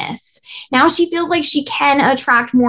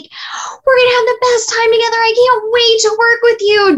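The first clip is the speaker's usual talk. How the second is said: mock gushing, much higher pitch; overexcited; 7 semitones higher than usual. Coach imitating an overeager seller gushing at a client. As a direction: play the seller who's way too eager. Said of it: "your energy is way excited and you're like".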